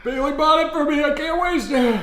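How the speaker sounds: strained pained voice. Real, not acted. Pushing through spicy pain.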